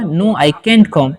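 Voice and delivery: each word spaced out slowly for students; slow dictation. Shown as a demonstration. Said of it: "इस तरीके से बोल सकते हैं". (धीमे-धीमे बोलकर लिखवाना)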